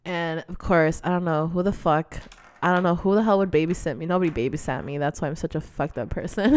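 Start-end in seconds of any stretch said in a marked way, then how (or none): none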